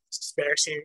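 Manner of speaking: usual speaking voice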